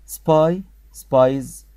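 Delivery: "سباي" then "سبايز" called out loud; "سباي" and "سبايز" spoken loudly, the second with a z ending; loud plural demo. Shown as a demonstration. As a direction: announce "سباي" and "سبايز" loudly, adding a z sound. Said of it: "سباي يعني جاسوس".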